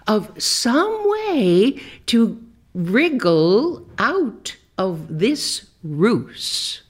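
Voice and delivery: usual speaking voice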